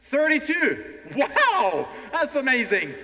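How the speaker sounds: very high, loud amazement; thrilled announcement. Real, not acted. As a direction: announce the top score with a big wow. Announcing the contest's top score.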